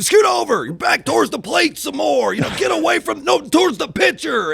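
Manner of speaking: high, loud shouted coaching from the stands; hammed-up sports dad shouting across the field; yelling sideline parent. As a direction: holler instructions out to the batter.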